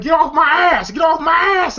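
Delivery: high mock shout; high-pitched, acted-out yelling; hammed-up protest. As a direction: imitate someone yelling to be let go. Acting out Smokey's struggle in house.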